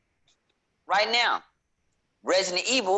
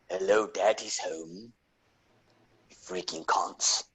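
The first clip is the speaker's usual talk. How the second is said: deep, slow British tough-guy voice; growing softer, play a low British brawler; put-on deep British accent, drawn out; Butcher; 6 semitones lower, 3 dB quieter, slower than usual. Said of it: "My British voice as the guy that was on The Boys".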